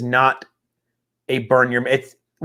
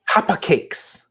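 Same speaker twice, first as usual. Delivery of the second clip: mock Chinese accent; 4 semitones higher, slower than usual. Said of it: "That just sounds like".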